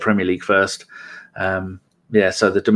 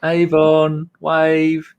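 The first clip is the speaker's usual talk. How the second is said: drawn-out call, raised and sustained; 5 semitones higher, 4 dB louder, much slower than usual. Pretend. Host to a viewer lost in the chat, calling out. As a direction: call out for someone who is lost.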